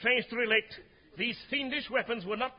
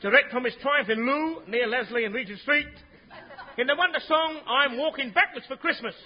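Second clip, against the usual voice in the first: grand posh compère voice; posh announcer; 4 dB louder than usual. Acted. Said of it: "talk this bit what I wrote".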